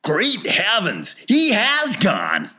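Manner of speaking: slow, high, loud exclamation of shock; father's shout. A narrator's reading. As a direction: shout it out, stretching the words. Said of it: "shouted Mister T V".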